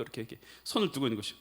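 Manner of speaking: ordinary delivery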